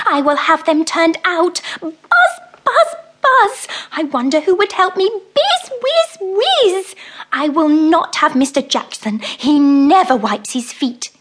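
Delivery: high, loud fussing mouse with buzzing mimicry; grumbling mouse. Mrs Tittlemouse grumbles about the bees, high fussing mouse voice. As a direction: fuss and fume, buzzing like the bees.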